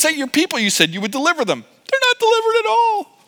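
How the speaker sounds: high-pitched mock whimper; Moses. Moses complains to God about his people, whiny. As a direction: whine it like a little baby. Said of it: "like a little baby".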